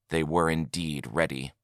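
ordinary delivery, nothing marked

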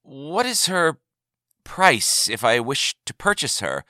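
tentative, starting high; a touch of nerves, feeling out the question; somewhat nervous. A narrator's reading. Buyer asking the trader a price, somewhat nervous. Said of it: "he began somewhat nervously".

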